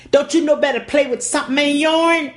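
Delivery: a stern father's rebuke; daddy scolding. Retold or imitated.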